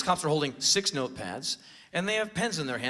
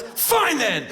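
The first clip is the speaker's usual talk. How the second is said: retold high, loud parting yell; indignant; 10 semitones higher, 6 dB louder than usual. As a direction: yell it in a mock huff. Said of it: "He was indignant".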